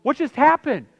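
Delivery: put-on high, loud startled kid voice; children outside in a sudden blackout; kids calling out. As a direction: mimic a child's alarmed shout. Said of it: "You hear kids going".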